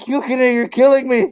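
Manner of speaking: high, loud mock-anguished cry; Yato in agony. Yato with spiritual cancer crying out to Yukine, mock anguish. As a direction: wail it as a hammed-up dying plea.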